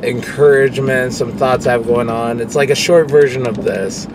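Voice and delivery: ordinary delivery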